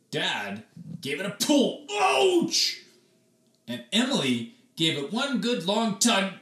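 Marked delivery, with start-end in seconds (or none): 1.9-2.8 sharp high ouch; high pained yelp. Acted. Dad yelps in pain at the stuck brush, high and sharp. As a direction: yelp as the hair yanks.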